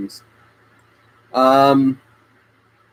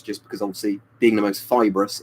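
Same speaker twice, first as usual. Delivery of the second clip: usual speaking voice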